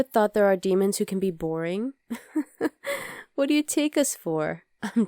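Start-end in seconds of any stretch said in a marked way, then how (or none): 2.0-3.3 quiet breathy rising chuckle; a light laugh at his own joke; soft laugh. Acted. Demon chuckling at his joke to his date.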